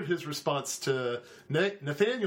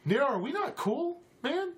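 higher, hurt challenge; wary Steven; 9 semitones higher than usual. Acted. Steven stopping mid-stride to challenge Nero, pitched up. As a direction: press him on whether you're still cool.

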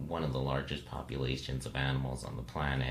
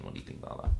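whispered question; much faster than usual. Real, not acted. Posing a question, voice dropping.

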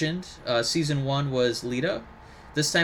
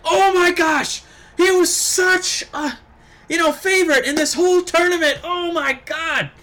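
excited commentator gush; 6 dB louder than usual. Retold or imitated. Mimicking Daniel Bryan's shocked high gush at Kota Ibushi's loss. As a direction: imitate a commentator losing it over an upset. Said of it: "falling out of his chair".

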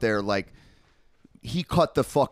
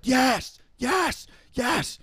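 high-pitched screamed cheers, spaced apart; screaming yes; 14 semitones higher, much slower than usual. Retold or imitated. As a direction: scream it out like a thrilled fan at a show. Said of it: "I just started screaming".

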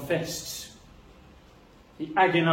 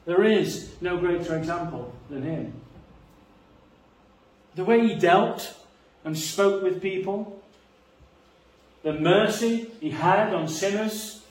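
raised, louder preaching voice climbing in passion; fervent preaching; 4 semitones higher, 4 dB louder than usual. Acted. Pastor exalting Christ's meekness to the congregation, fervent. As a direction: preach it out with rising fervor.

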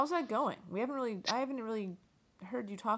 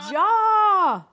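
high, very loud drawn-out call; joking full-volume yell; playful shout; 18 dB louder than usual. Pretend. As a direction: holler it long as the answer to a call. Host jokingly completes yelled call.

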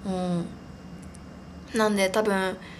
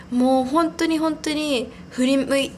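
ordinary delivery

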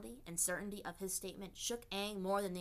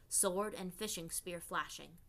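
ordinary delivery, nothing marked

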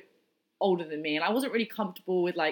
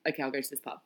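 begrudging; 6 semitones lower, 8 dB quieter, much faster than usual. Retold or imitated. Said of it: "kind of begrudgingly was like".

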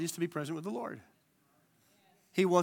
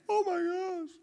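mock amazed gasp; 13 semitones higher, slower than usual. Amazed customer in cab.

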